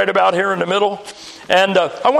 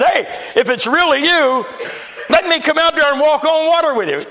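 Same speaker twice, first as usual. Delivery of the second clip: Peter's challenge, lightly acted a little higher; a hint of a bold disciple, pitched up; lightly voiced Peter; 6 semitones higher than usual. Peter testing the Lord on the water, lightly acted challenge. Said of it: "Peter kind of tests the Lord and he said".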